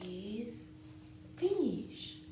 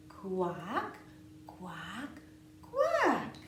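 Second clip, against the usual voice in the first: duck quacking; 4 dB louder, much slower than usual. Pretend. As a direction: quack like a playful duckling. Duck refrain.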